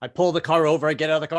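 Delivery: ordinary delivery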